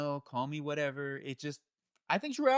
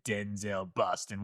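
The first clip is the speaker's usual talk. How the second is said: put-on low, slow Boston accent; 5 semitones lower, 4 dB quieter, slower than usual. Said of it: "like, a Boston accent".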